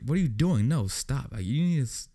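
high, indignant mock protest; retold scolding. Passenger to lady pushing people around, indignant scolding. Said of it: "And he's just like".

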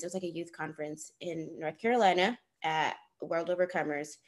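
ordinary delivery

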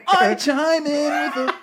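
high, slow, cartoonish squeal; high silly voice. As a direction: stretch it out in a squeaky voice. Repeats the squeaky stinger.

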